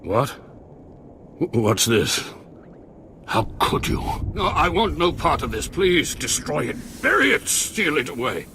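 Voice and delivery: rising pitch, panicky refusals; scared. Acted. Sith overseer panicking at the heretic's secrets, frightened. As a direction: recoil from the forbidden teachings in fear. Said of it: "You sound a little scared".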